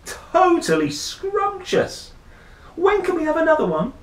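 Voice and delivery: high, loud delighted yell; shouted. Acted. Rory answering his parents about the babysitter, gleeful shout. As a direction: cry out with glee. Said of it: "shouted Rory".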